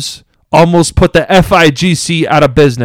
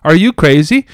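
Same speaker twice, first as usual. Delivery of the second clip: retold angry outburst; 9 semitones higher than usual. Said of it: "called him out and said".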